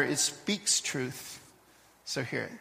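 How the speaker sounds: slow, hushed lead-in to the parable. Real, not acted. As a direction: drop to a near hush to grab them. Preacher hushes before the story.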